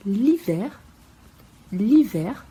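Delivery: l'hiver said lee-VAIR, no h; teacher showing the mute h in l'hiver; silent h. Shown as a demonstration. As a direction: drop the h in l'hiver completely. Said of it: "o H tem um som mudo".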